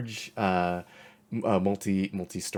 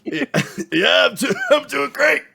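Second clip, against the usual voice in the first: strangled voice; 12 dB louder than usual. Real, not acted. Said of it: "You sound like you're choking a little".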